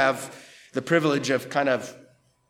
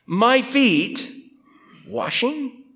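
"my feet" pitched high and leaned on; incredulous high stress on "my"; emphatic MY; 8 semitones higher, much slower than usual. Shown as a demonstration. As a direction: lift "my feet" high, then a questioning "washing". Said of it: "it's emphatic".

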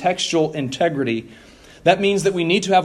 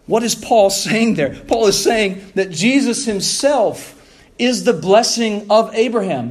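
raised, impassioned preaching voice; preaching at full force; 4 semitones higher than usual. Real, not acted.

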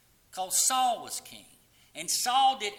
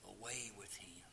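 hushed whisper, much softer; 11 semitones lower, 16 dB quieter than usual. Acted. Preacher echoing the crowd's cry to his congregation, whispered. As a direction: breathe the words like a grim echo.

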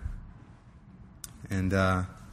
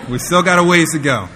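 amused, laughing it out loud; laughing, louder; 4 semitones higher, 9 dB louder than usual. Real, not acted. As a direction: laugh through the words. Father laughs at daughter's answer.

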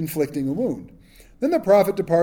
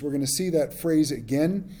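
ordinary talk, nothing marked

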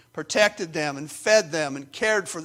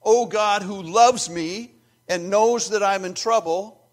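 raised, impassioned plea to God; acted prayer, pitched up and swelling louder, slowing at the close; crying out; 4 semitones higher, 6 dB louder than usual. Psalmist calls on both names of God, impassioned cry. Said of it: "I'm gonna cry out to Adonai too".

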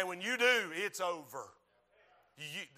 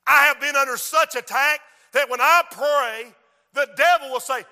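full-force preaching; 7 semitones higher, 12 dB louder than usual. Real, not acted. Preacher listing the devil's attacks, full-force preaching. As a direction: hammer out each charge to the congregation.

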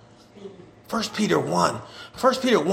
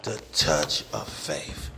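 breathy whisper; 8 dB quieter, much slower than usual. Acted. Preacher reveals the key phrase.

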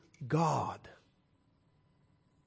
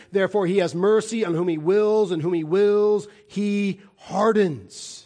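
full-force preaching; 3 semitones higher than usual. Acted. Preacher reading Romans nine to the congregation, preaching at full force.

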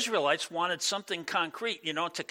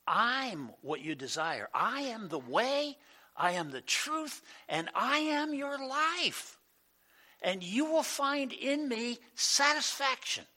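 God speaking; 8 semitones higher, slower than usual. Acted. Pastor voices God's gentle invitation.

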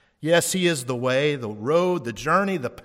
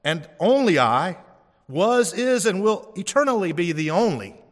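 emphatic pulpit proclamation; booming divine voice; 5 semitones higher, 6 dB louder than usual. Acted. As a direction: thunder it out as God's own claim. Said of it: "he says".

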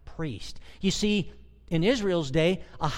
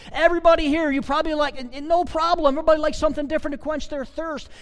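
much higher, louder, fast excited preaching; 9 semitones higher, 6 dB louder, faster than usual. Acted. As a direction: fire up the congregation. Preacher working up crowd.

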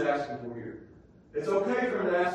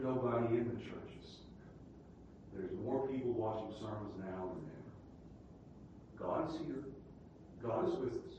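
much softer, lower, gentle comfort; soft reassurance; 6 semitones lower, 11 dB quieter than usual. Real, not acted. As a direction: bring it down and reassure them. Soft reassuring after the shout.

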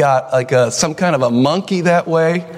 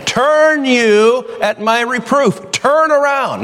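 yelling it out; 10 semitones higher, slower than usual. Shown as a demonstration. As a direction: cry it out like wisdom calling in the street. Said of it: "she's yelling it out".

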